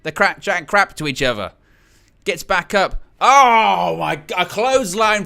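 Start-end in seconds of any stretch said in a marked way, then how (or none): 3.2-5.2 shocked outburst. Real, not acted. Stunned by the sudden clothesline, a loud cry. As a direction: gasp it out in disbelief.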